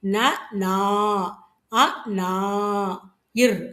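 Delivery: short ந, then long drawn நா; அ, long நா, then a separate இர்; sound-by-sound breakdown. Shown as a demonstration. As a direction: spell out அ, நா, இர் sound by sound with pauses. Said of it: "ஆ உச்சரிப்பு கொண்டு வரணும்".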